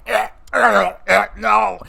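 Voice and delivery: high, strained grunts through a chokehold; mock being choked as you refuse; imitating a wrestler gasping out a no in a chokehold; choked-out grunting refusal. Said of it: "He's just like".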